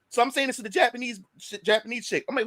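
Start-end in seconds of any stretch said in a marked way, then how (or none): none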